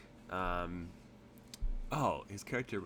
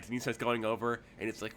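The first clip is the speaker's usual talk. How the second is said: usual speaking voice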